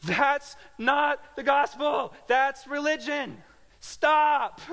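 high, loud and slow, yelling; frustrated yell at the radio preacher; shouting at radio. Retold or imitated. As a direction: yell it at the radio like he can hear you. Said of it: "screaming at my radio".

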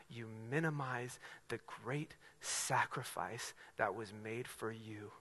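soft, slowing appeal to the congregation; quiet pulpit plea; hushed earnest preaching. Real, not acted. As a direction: bring it right down, gentle and grave.

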